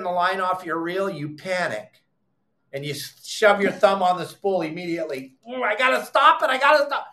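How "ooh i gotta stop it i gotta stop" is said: high, fretful mock whine; mock panicked angler. Imitating a panicking fisherman to viewers. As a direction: play the flustered guy grabbing the spool.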